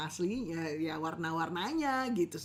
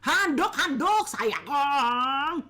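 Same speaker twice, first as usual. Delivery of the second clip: loud, high, slow, impatient "handuk, handuk"; barely holding the sweetness; six-years-married husband snapping sweetly; irritated calling; 5 semitones higher, 7 dB louder, much slower than usual. Retold or imitated. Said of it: "intonasi udah berubah".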